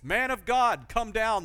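loud, high mocking summons; mocking call. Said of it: "supposedly in a mocking voice".

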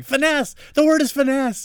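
high, loud shout of the word; yelling. Pretend. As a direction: shout the answer at the podcast. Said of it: "you're gonna be yelling at the podcast".

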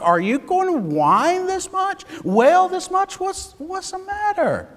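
building mock exasperation toward a high whine; exasperated disbelief. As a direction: scold David in mock outrage. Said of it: "I'm thinking".

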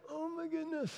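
a stunned little exclamation; dismayed gasp. Retold or imitated. Dead battery dismay.

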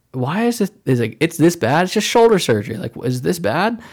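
high, loud incredulous questioning; retold freak-out. Patient questioning a surgeon's grim verdict, freaked out. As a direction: replay being freaked out, pitch jumping up in disbelief. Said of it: "it freaked me out".

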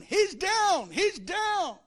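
loud, high ringside shout; announcing the giant's fall; excited fight call. Acted. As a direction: call the knockdown like a boxing announcer.

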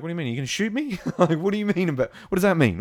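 imitating Mayfeld's startled protest; mock indignant. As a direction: play the offended soldier.

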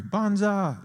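high, drawn-out leaping cry; playful daredevil cry; mock battle cry. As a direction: call out as if jumping off a cliff. Leap off the cliff.

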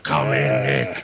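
deep mock greeting. Host answering the door to Nick: deep put-on welcome. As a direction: drop low and welcome him in.

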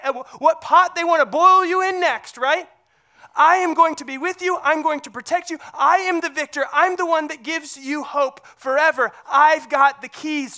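full-voiced pulpit assurance; preaching. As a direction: proclaim it like a rally cry. Preaching Jesus' voice at force.

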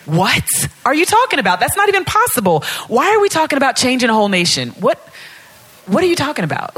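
high, rushed disbelief; incredulous protest. Acted. Acts out disbelief at God's dreams.

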